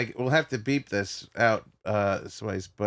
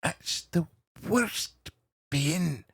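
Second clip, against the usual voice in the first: higher, measured Scottish storyteller; Billy Connolly; 5 semitones higher than usual. Acted. Comedian recalling a holiday warning about stonefish, Scottish impression. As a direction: pause between phrases like a Scottish stand-up. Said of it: "Billy Connolly talk about the stonefish".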